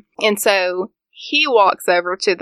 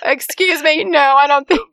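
skeptical objection; 6 semitones higher than usual. Retold or imitated. Voices the skeptical co-host objecting.

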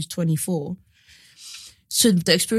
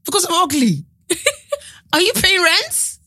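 hammed-up disbelieving father, much higher; mocking dad; 10 semitones higher than usual. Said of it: "he was like".

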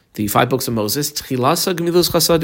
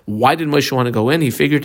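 ordinary talk

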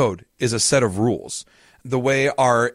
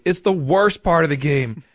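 higher, slow mock whine of a kid; mock sobbing; 5 semitones higher, 3 dB louder, slower than usual. Crying kid protesting to mom over Monopoly, mock sobbing. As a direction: imitate a child wailing, pitched up and drawn out. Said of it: "And he's sobbing".

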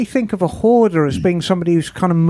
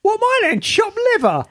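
mock offended; 13 semitones higher than usual. Mock offended much higher.

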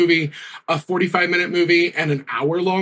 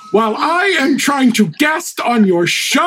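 mock outrage; 10 semitones higher than usual. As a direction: play scandalised guest. Mock complaint about gum.

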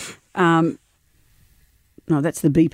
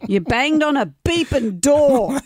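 higher, drawn-out mock outrage; hammed-up accusing read; retold angry accusation; 7 semitones higher, slower than usual. Host acting out the transcript of the row, mock outrage.

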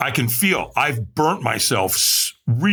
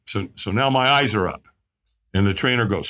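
ordinary talk

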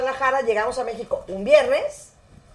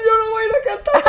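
high, loud mock wail, laughing through it; imitated crying; 10 semitones higher, 4 dB louder than usual. Maite refusing to sing, mock crying wail. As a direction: whine it like a scared crying girl. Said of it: "llorando y les dijo".

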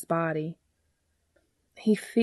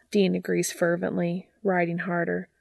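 ordinary delivery, nothing marked

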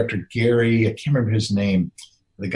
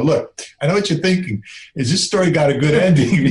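playful; 5 semitones higher, 4 dB louder than usual. Retold or imitated. Teasing the audience.

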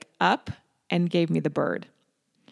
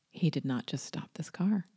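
whispered thought; 8 dB quieter than usual. Retold or imitated. Inner thought as he stops.

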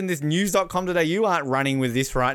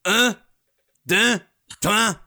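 French president counting; 3 semitones higher, slower than usual. Retold or imitated. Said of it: "He's like".

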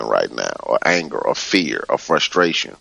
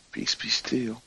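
near-whispered calming; 4 semitones higher, 11 dB quieter, slower than usual. Retold or imitated. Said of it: "sometimes I'll just say".

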